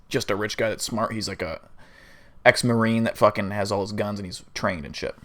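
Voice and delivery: usual speaking voice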